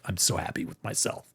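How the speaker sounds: hushed self-satisfied whisper; contented whisper. Retold or imitated. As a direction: sigh it out, utterly pleased. Blissful whisper.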